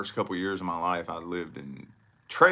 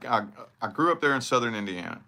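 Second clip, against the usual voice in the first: normal talking voice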